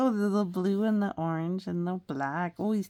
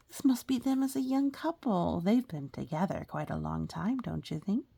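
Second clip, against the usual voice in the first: ordinary delivery, nothing marked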